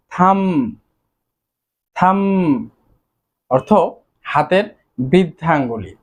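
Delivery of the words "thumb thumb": থাম said slowly and clearly, with the final b silent; careful pronunciation. Shown as a demonstration. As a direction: model the word থাম for the class. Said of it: "উচ্চারণ হবে".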